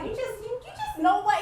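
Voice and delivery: hammed-up flustered wife; mock exasperated. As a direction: sputter at him in mock frustration. Acting out exchange with husband.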